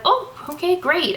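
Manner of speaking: high, breezy retold reply; imitating the doctor. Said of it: "and she's like".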